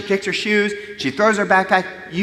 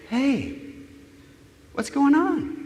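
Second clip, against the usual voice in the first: soft, higher, caring check-in; changed, gentle tone; 9 semitones higher, 5 dB quieter, slower than usual. Shown as a demonstration. Parent meeting upset daughter with right brain, gentle. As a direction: open softly, with a pause after the greeting. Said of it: "Notice the tone change?".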